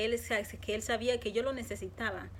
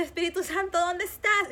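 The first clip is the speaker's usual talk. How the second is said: retold cry, higher and louder; desperate call; 7 semitones higher, 5 dB louder than usual. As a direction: call out for him in despair. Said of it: "como si volviéndome loca".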